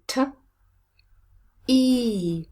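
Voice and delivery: t alone, then e as a long ee; isolated sounds. Shown as a demonstration. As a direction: separate t and long e. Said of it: "long vowel".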